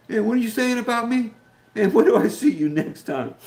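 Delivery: mock friend's reply. Pretend viewer catching him talking about him, mock indignant. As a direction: play the friend calling him out.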